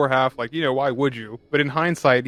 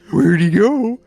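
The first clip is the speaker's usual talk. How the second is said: put-on high, loud peekaboo voice to a baby; baby hide-and-seek voice; 7 semitones higher, 8 dB louder, slower than usual. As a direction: sing out the question as if playing peekaboo. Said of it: "play hide and seek with a baby".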